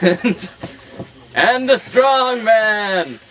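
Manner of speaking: high, loud, slow drawn-out announcement; circus announcer. Acted. Teller announcing the strong man, slow booming ringmaster call. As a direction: stretch it like a ringmaster's big reveal. Narrator announces next act.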